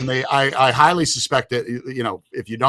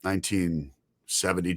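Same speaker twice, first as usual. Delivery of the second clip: usual speaking voice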